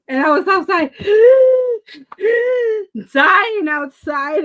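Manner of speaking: high, loud gasping retelling; choking on fumes, gasping; gasping for air. Retold or imitated. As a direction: relive struggling to breathe. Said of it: "trying to breathe".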